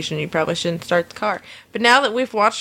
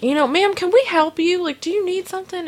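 higher, strained polite voice; owner's polite challenge; 10 semitones higher than usual. Retold or imitated. Store owner asking the drunk customer what she needs, higher strained politeness. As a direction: press her politely but pointedly.

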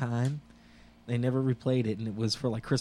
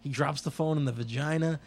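normal talking voice

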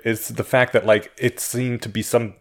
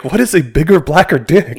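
loud, higher-pitched mock bewilderment; imitated clueless parent; 6 semitones higher, 9 dB louder than usual. Mimicking insulated parents puzzled by a crude card, mock bewilderment. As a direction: put on an innocent parent's baffled question, loudly.

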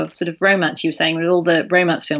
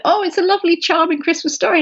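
much higher, sing-song gushing; mock gushing; 10 semitones higher than usual. People who find the story charming, mock gushing. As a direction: imitate people cooing over something sweet. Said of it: "a lot of people go".

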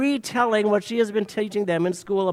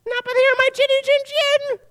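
squeaky little pig; 3 dB louder than usual. Acted. Pig answers the wolf again.

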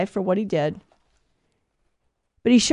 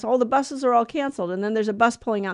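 normal talking voice

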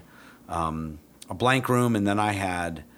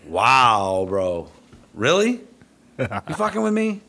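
loud wow climbing into high-pitched questioning; retold disbelief; 4 semitones higher, 6 dB louder, slower than usual. Trainer suspecting the handler is pranking him, incredulous. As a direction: act out baffled disbelief, pitch shooting up. Trainer reacts to absurd mistake.

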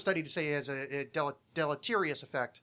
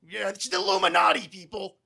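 much louder ranting conspiracy host; Alex Jones impression; 4 semitones higher, 10 dB louder than usual. Mocking the host he wants to sound like, loud rant. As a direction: bark it like a shouting radio conspiracy host. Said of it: "I can't do his voice".

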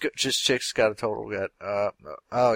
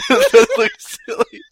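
high, slow, laughing words; 6 dB louder, slower than usual. Real, not acted. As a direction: giggle it out helplessly. Laughing at a funny image.

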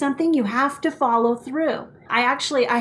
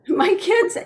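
ordinary talk

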